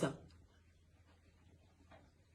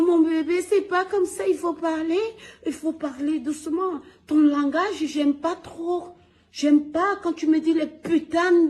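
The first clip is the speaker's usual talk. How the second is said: high, gentle scolding mother voice, louder at first; imitating his mother; 9 semitones higher, 4 dB louder, slower than usual. Son imitating mum scolding his rough language, high and gentle. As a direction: coax like a mother correcting her baby, pitched high. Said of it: "Elle me dit".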